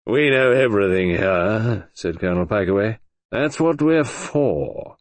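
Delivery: deadpan, unhurried; dry old colonel. Acted. Pikeaway grand claim.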